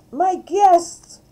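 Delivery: high, loud squeal of excitement; excited cry. A narrator's reading. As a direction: cry out with delight, pitch way up. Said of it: "cried D W".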